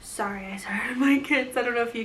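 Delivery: hushed aside, half whispered; whispering. Real, not acted. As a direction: drop to a whisper, distracted. Hears kids, lowers voice.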